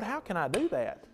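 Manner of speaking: soft, wondering plea to the Lord; quiet plea. Retold or imitated. Preacher voicing Phil praying to the Lord, hushed. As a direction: ask it softly, almost to yourself. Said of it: "and I'm saying, 'Lord".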